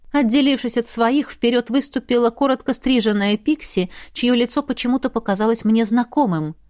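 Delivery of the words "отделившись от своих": usual speaking voice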